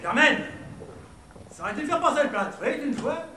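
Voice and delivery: hammed-up Belgian accent. Shown as a demonstration. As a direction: call out like a joke Belgian. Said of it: "c'est l'accent belge".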